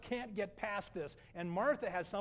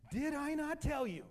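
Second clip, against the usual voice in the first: loud, high-pitched challenge; stern rebuke; 8 semitones higher, 5 dB louder than usual. Acted. Jesus reminding doubting Martha at the tomb, sternly. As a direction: ring it out as a forceful reminder.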